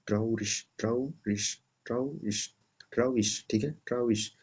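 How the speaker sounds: ट्राउरिश ending on श, repeated; ट्राउरिश, stress on ट्राउ; pronunciation drill. Shown as a demonstration. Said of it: "श की तरह पढ़ते हैं".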